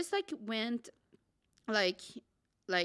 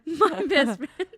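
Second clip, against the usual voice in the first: laughing; 10 semitones higher, 7 dB louder, slower than usual. Real, not acted. Laughing, retelling her drama.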